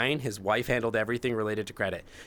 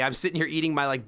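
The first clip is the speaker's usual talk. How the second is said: ordinary talk, nothing marked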